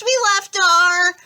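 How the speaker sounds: high, loud, slow alarmed cry; panicked cut-off. A narrator's reading. Grover realizes the bags are lost, high and loud. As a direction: blurt it in alarm.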